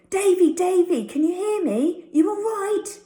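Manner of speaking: high, loud call to a dazed friend; shouting. Acted. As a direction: shout his name to wake him. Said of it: "Tucky seemed to be shouting".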